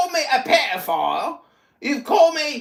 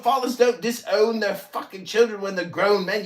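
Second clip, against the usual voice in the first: normal talking voice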